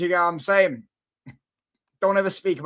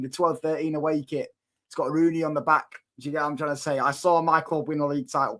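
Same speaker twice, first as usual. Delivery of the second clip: ordinary talk, nothing marked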